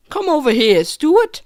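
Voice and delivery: high-voiced British mum calling her child; hammed-up British mum; British accent. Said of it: "pioneers had British accents in that scene".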